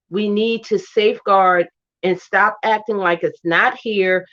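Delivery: ordinary delivery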